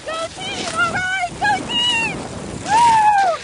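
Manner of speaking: loud, high cheer. Real, not acted. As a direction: cheer the team on at full voice. Cheering dogs at start.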